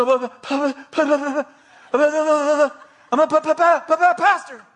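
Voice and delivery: high, panicky stammer building to the word; stutter. Retold or imitated. Shaken speaker answers the man shaking her, high frantic stutter. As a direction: trip on the first syllable, then blurt it out. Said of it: "I stut-, stutter really bad".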